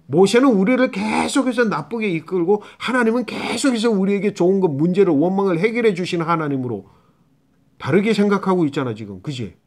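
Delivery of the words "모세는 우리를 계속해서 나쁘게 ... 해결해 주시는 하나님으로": higher, lightly acted complaint; mildly aggrieved crowd, pitched up; grumbling Israelites. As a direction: voice a touch of the people's grievance. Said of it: "그렇게 지금 이스라엘 백성들이 생각하는 거야".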